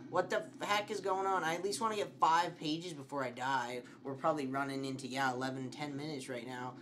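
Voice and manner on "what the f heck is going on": usual speaking voice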